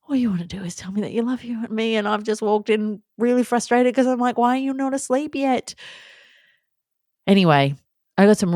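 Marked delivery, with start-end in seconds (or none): 0.1-5.7 self-reproach. Retold or imitated. Mother berating herself, acted.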